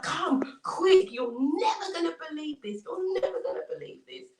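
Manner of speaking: high, urgent amazed call; acted giddy disbelief, pitched high; excited. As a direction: call out with breaking news. Neighbours excited by the miracle.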